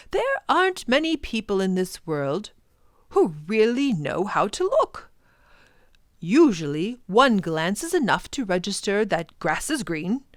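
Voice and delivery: a professor who wants them to care; lightly voiced professor, earnest.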